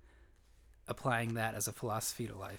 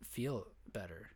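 ordinary delivery

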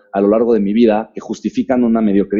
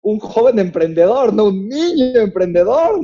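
imitated astonished guest; 11 semitones higher than usual. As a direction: marvel at the kid organizer. John's astonished remark.

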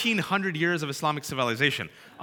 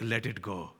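uncle's voice; 7 semitones lower than usual. Retold or imitated. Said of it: "he's like".

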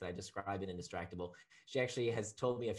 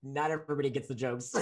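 ordinary talk

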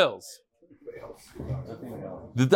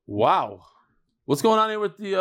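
ordinary delivery

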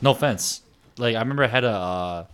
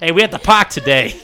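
put-on playful Boston accent with a laugh; 4 semitones higher, 5 dB louder than usual. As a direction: clip the r in park, Boston style. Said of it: "I'm gonna talk like that tomorrow".

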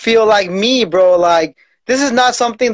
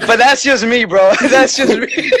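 laughing; 3 semitones higher than usual. Real, not acted. Laughing at own joke.

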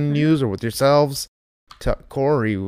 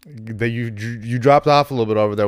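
ordinary talk

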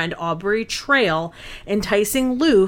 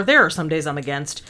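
ordinary talk, nothing marked

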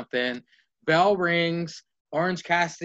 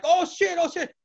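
high, squeaky alarmed cursing; cartoonish panic; hammed-up high-pitched shock; mock alarm; 11 semitones higher than usual. [name] reacting to the tank.

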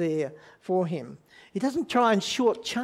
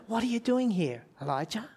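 softer, tender, the name trailing away quietly; gentle inviting question; 3 semitones higher, 5 dB quieter, faster than usual. Shown as a demonstration. God gently inviting Elijah to open up, soft and tender. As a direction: ask it kindly, as an open invitation. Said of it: "it says it quite differently, doesn't it".

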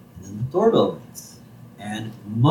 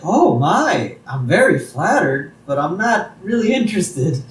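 higher, polite Marge; 6 semitones higher than usual. Retold or imitated. Marge turning down Moe's flowers, higher and polite. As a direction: let Marge down him gently in a higher voice. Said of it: "Marge's like".

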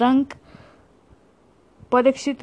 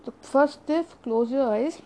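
guided meditation; 5 dB quieter than usual. Acted. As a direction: ease them gently into stillness. Leads breathing meditation.

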